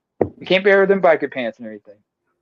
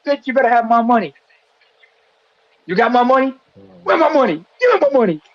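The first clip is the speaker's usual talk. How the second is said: demanding money; 9 semitones higher than usual. Acted. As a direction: shake her down for cash. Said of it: "look at you, Khalees, and say".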